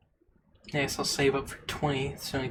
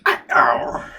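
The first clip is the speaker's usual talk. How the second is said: loud shrieking goblin cry; gross nasal goblin; 8 dB louder than usual. Acted. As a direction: give it your best gross goblin squeal. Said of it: "That's my best gross, nasally voice".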